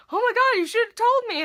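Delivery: imitated high, loud outcry; shocked exclamation. Boss hearing about it the next day, loud shocked imitation.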